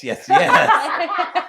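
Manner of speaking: high, loud, giggly yes yes; laughing giddy yes. Retold or imitated. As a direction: give in with a laughing squeal. Giving in to the hookup, laughing.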